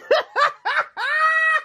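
high-pitched squealing laugh; excited laugh. Real, not acted. Scratcher spotting a likely win, high excited laugh. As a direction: burst into a gleeful high cackle. Thrilled at a possible winning ticket.

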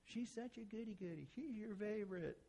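put-on small, high, soft child voice; jealous kid. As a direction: grumble softly in a high mock-kid voice.

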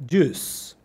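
"Juice" with a silent I; one-syllable "Juice", the I not sounded; juice without the I. Shown as a demonstration. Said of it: "no pronunciar la I en jugo". ("Juice" sin pronunciar la I)

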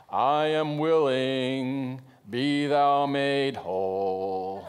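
very slow, drawn-out churchy King James voice; mock the solemn pious recitation; stiff religious intoning. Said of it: "This isn't just some religious".

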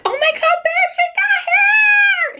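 very high, loud, drawn-out squeal; amazed shriek. Retold or imitated. Mom tells partner the baby has hair, amazed shriek. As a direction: squeal it to your partner, thrilled.

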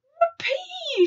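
very high, loud whoop; jubilant shout. A narrator's reading. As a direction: shout out in glee. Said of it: "shouted the B F G".

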